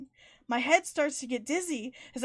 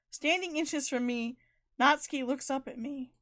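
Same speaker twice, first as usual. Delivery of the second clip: usual speaking voice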